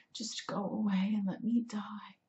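softly whispered dying plea. A narrator's reading. Dying cat begs the dogs to leave, whispering. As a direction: whisper it with no strength left. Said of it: "I whispered".

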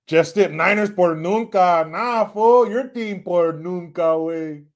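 loud Chicano fan heckle; drawn-out Chicano trash talk; Chicano homeboy accent. Acted. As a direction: taunt in a sing-song Chicano accent. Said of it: "I can do that accent so well".

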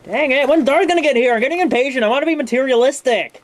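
impatient excitement. Acted. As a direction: whine that you can't wait any longer. Impatient toy waiting for Darth.

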